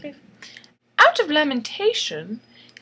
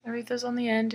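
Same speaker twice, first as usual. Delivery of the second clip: ordinary delivery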